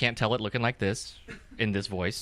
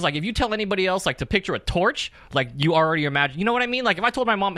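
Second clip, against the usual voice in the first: usual speaking voice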